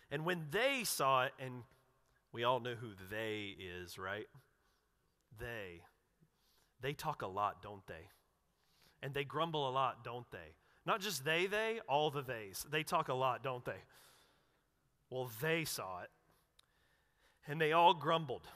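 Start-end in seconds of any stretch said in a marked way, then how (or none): none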